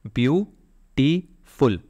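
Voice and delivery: ब्यूटीफुल drawn out in three separate beats, byu-ti-ful; syllable by syllable. Shown as a demonstration. Teacher counting syllables for learners, ब्यूटीफुल slowly split in three. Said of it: "तीन syllable हो गए".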